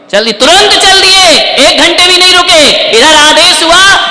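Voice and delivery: high, loud, ringing proclamation; preaching at full force. Real, not acted. Preacher telling of Ram's instant departure, full force. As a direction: proclaim it loudly to the hall.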